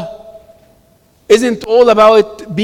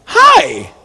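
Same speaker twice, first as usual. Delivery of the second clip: higher-pitched, bright retold "hi"; chirpy greeting; 11 semitones higher than usual. Speaker retells greeting the museum receptionist, chirpy greeting. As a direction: lift it high in a friendly hello.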